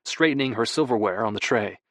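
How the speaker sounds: ordinary delivery, nothing marked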